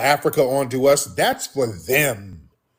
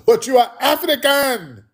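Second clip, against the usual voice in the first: higher, slowed skeptical voice; mock doubter; 10 semitones higher than usual. Host mimicking a skeptic who calls him African, higher and slow. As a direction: push back like a doubting questioner.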